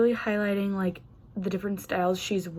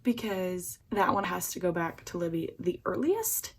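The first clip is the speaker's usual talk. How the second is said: usual speaking voice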